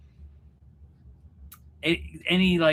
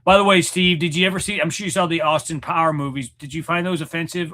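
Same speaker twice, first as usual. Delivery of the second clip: normal talking voice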